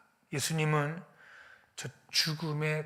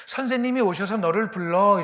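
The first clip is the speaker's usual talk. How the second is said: loud, raised summons; calling someone over; 5 semitones higher, 9 dB louder than usual. Retold or imitated. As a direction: call your sister, higher and much louder. Acting out Martha summoning her sister.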